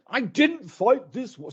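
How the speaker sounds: mock Churchillian defiance; Churchill impression. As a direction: growl it out like wartime Churchill. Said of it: "I do a Churchill accent".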